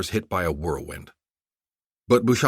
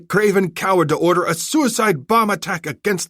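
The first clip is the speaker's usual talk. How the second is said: scornful taunt; 11 semitones higher, 5 dB louder than usual. Acted. Commander taunting the general.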